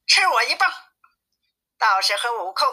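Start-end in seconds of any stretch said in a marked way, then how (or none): 0.1-0.7 higher-pitched fighting cry; battle challenge. Acted. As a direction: challenge the demons as you strike, without shouting. Wukong attacks the demons. (提高音调喝战)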